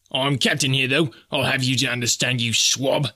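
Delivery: shrieking. Acted. Snipes asserting command over the sailors, mild shriek. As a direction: shriek it at the crew, puffed up. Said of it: "shrieked Snipes".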